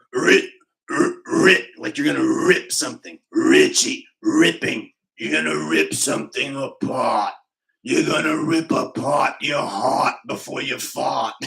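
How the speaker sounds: loud straining sandpaper voice, Boston accent; Richie from Boston. Shown as a demonstration. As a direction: rip the words out like a constipated old man. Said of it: "The secret to Richie from Boston".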